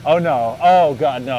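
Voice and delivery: rising panic into oh God; hearing the engine knock in dread. Retold or imitated.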